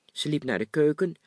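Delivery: plain reading voice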